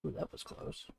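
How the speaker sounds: breathless relieved whisper. Real, not acted. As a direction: whisper it, just dodged a mistake. Near slip while drawing.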